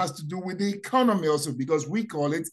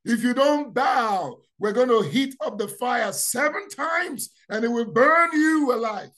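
King Nebuchadnezzar; 4 semitones higher than usual. Retold or imitated. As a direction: bellow the king's threat to bow or burn. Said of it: "that came from Nebuchadnezzar".

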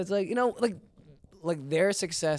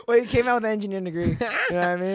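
laughing; 7 semitones higher, 7 dB louder, faster than usual. Real, not acted. Laughing at friend's low grade joke.

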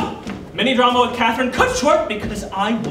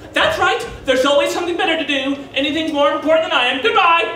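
raised, huffy parting shot; offended mother; 4 semitones higher than usual. Acted. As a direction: act out a mother ending the call in a huff. Mother quarrels, then hangs up.

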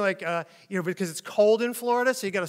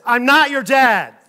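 slow, loud, higher call-out; loud announcement; 5 semitones higher, 13 dB louder, slower than usual. Acted. Stranger to child touching his leg, loud call-out. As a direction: announce it loudly, drawn out.

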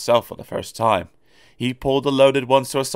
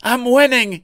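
high, strained gasp; 4 dB louder than usual. A narrator's reading. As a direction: gasp it out under heavy strain. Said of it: "he gasped".

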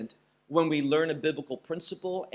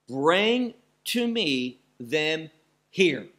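very slow "Bring to me them" with "Bring" stressed; slow commanding stress on "Bring" and "here"; emphasized; 3 dB louder, slower than usual. Shown as a demonstration. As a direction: drag each word out and punch "here". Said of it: "that's what's emphasized once more".

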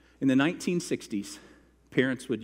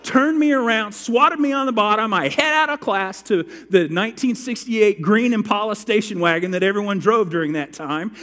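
high, loud building story; raised, loud dramatic recounting; heated retelling; 8 semitones higher, 9 dB louder than usual. Retold or imitated. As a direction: tell it like it still stings.

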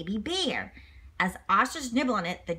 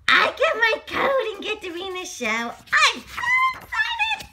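thrilled George; 12 semitones higher, 5 dB louder than usual. Acted. George hearing he's in the show, overjoyed. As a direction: shriek with joy.

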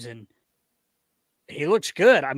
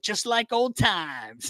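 higher, drawn-out retold line; slow, raised-pitch quote of the fighter; retold opponent; 5 semitones higher, much slower than usual. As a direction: mimic him, stretching the words out slowly. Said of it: "he's like".

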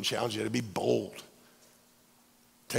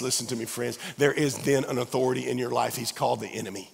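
normal talking voice

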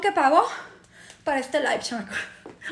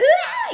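high-pitched hammed-up wail; shriek-cry like a drama queen; mock wailing; 14 semitones higher, 4 dB louder than usual. Imitating girls being calmed by the coordinator, high mock wail. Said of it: "Llorando en un drama".

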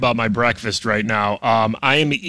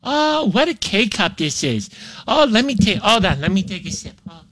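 mock vacation bliss; 5 semitones higher than usual. Pretends Caribbean vacation over coffee.

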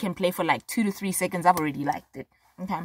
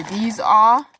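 ordinary talk

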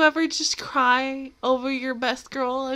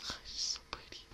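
whisper; 24 dB quieter, much faster than usual. Real, not acted. Whispering adoration at screen.

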